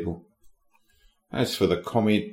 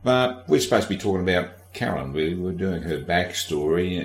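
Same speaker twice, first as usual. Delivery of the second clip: ordinary talk, nothing marked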